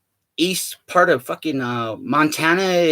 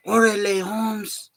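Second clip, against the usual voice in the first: put-on higher-pitched Chicano homie accent; Mexican accent; 6 semitones higher, much slower than usual. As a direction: do a mock Mexican-American homeboy accent. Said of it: "well, there's the accent".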